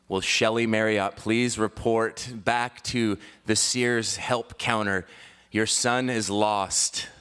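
slow mock store announcement; measured, drawn-out announcer imitation; intercom announcer. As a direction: page someone over the mall intercom, word by word. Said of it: "like, an intercom for the whole mall".

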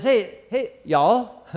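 a friendly Texan drawl on the greeting; Texan accent. Retold or imitated. Teacher voicing John the Baptist to the crowd, in a mock Texan accent. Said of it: "that's my Texan for you".

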